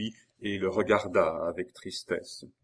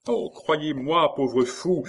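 high, louder, fond reassurance; smiling; 8 semitones higher, 7 dB louder, slower than usual. A narrator's reading. Geneviève reassures jealous Maurice, smiling. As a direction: draw out a smiling plea. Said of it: "dit-elle avec un sourire".

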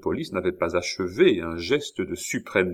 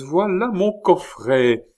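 high and louder, slow; excited cry; 10 semitones higher, 5 dB louder, slower than usual. A narrator's reading. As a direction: proclaim the box as hers. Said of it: "s'écria la comtesse".

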